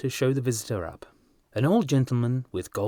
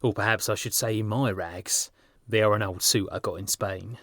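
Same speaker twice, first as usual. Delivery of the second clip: plain reading voice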